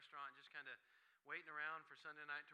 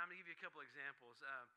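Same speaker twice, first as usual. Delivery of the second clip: usual speaking voice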